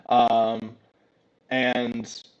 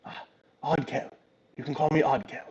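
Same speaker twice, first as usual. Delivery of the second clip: soft, lightly voiced shield introducing itself; hesitant little companion voice; talking shield; 2 semitones higher, 5 dB quieter than usual. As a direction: quietly give your name as a small creature.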